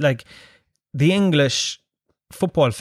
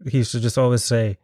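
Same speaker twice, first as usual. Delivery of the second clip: ordinary talk, nothing marked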